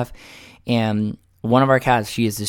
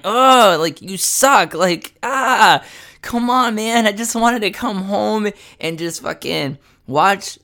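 exasperated, not screaming; 6 semitones higher than usual. Retold or imitated. Man retelling scolding his cat at three a.m., exasperated. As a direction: re-enact a fed-up rant at the cat. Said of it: "the way I'm just saying it now".

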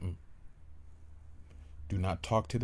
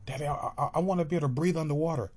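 put-on higher, louder, fast eager kid; child voice; 6 semitones higher, 4 dB louder, much faster than usual. Child begging daddy, high and rushed. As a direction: stammer out the wish like a little kid.

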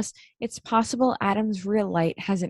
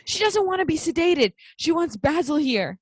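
high, hammed-up commanding cry; mock urgent shout; 7 semitones higher than usual. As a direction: burst in imitating a girl halting doctors. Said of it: "and just goes".

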